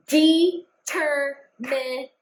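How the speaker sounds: slow, syllable by syllable with claps; clapped out syllables. Shown as a demonstration. Teacher claps out determination slowly for the class. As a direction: stretch the word out beat by beat. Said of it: "We're gonna clap this out together".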